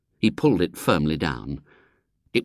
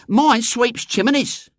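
put-on very high, slow girl's voice; boastful girl; 4 dB louder, slower than usual. A narrator's reading. Eglantine telling of her father's job, high and proud. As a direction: announce it in a high Cockney girl's voice.

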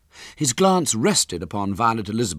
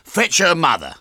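higher, louder command after a breath; loud order; 9 semitones higher, 7 dB louder than usual. A narrator's reading. Father sending for the mother, loudly. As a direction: call out the order. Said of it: "he took a deep breath".

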